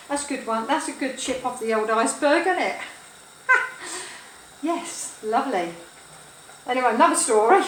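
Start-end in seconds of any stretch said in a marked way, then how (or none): 3.5-4.2 brief, high burst of laughter; short laugh. Real, not acted. Comic after mangling a saying, short laugh. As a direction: laugh at your own joke.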